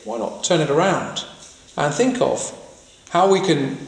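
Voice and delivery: ordinary talk, nothing marked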